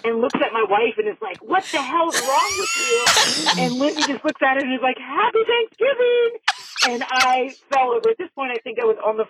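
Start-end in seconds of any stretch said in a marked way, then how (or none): 1.4-3.6 high, drawn-out indignant demand; imitated outrage. Retold angry woman confronts the wife. 5.0-6.3 very high, drawn-out singsong cheer; mock-cheery greeting. Retold wife's cheeky greeting.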